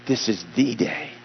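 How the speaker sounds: THE said as a stressed "thee". Shown as a demonstration. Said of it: "wherever you put the emphasis".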